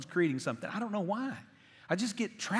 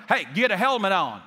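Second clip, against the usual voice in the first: yelled command; 7 dB louder than usual. Retold or imitated. Biker bully yelling at him.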